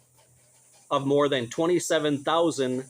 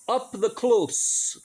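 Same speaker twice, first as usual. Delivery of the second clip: slow, drawn-out mock Scottish accent, pitched high; attempted Scottish accent; 9 semitones higher, much slower than usual. Host reciting an old poem to listeners, bad Scottish accent. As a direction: put on a hammed-up Scottish accent. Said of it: "in my best accent".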